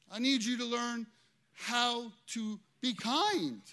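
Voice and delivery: loud, high, drawn-out appeal; preaching at full force. Acted. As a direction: plead with them slowly.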